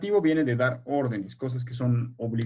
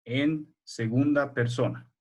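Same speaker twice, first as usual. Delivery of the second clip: slow, deliberate dictation pace; teacher dictating a note, slow; 3 semitones higher, much slower than usual. Shown as a demonstration. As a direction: say it slowly so the class can copy it down.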